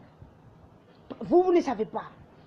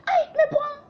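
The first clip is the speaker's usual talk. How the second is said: very high mock-exasperated urging; imitated parent, squeaky-high feigned coaxing; parents' pretend insistence; 13 semitones higher, slower than usual. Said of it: "mes parents font semblant, ils disent".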